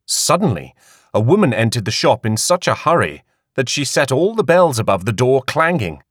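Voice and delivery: ordinary delivery, nothing marked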